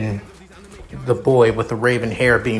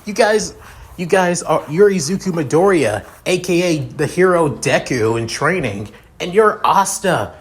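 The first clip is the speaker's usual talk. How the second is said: higher, lightly excited gushing; excited fan; 6 semitones higher, 3 dB louder than usual. Acted. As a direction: rattle off who they are with a touch of fan excitement. Fan character gushes on meeting heroes.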